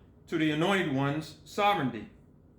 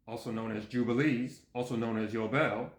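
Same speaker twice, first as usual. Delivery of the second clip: lower-pitched double gloss; parenthetical aside; 6 semitones lower than usual. Real, not acted. Reader glossing a book title, lowered aside. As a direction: step down in pitch for both alternative titles.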